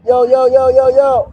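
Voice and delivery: loud, high call of yo across the street; hailing a friend from down the block. Real, not acted. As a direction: shout it out to get someone's attention. Calling out to someone on the corner.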